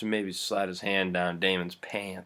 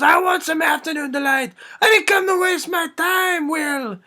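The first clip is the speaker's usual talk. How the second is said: high, loud frenzied yell; enraged screaming; 4 dB louder than usual. Acted. Hypnotist screaming at Will as he attacks, enraged. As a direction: shriek the demand at full pitch.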